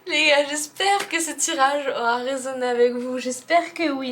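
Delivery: bubbly, high and loud; giddy and overexcited; too much caffeine. Real, not acted. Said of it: "Je suis surexcitée".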